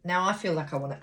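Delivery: normal talking voice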